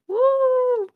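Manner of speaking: high, loud "woo" held long; excited whoop. Real, not acted. Podcast host cheering for Thor, excited whoop. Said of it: "we're excited to".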